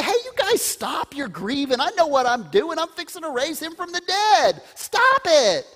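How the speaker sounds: high, loud, brusque mock scolding; mock impatient Jesus. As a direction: play a pushy fixer telling mourners to quit it. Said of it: "He doesn't say".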